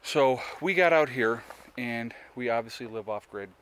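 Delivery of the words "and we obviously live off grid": usual speaking voice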